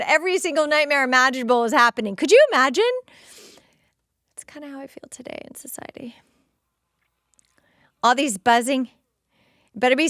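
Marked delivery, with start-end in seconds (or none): 4.3-6.2 whispered aside. Real, not acted.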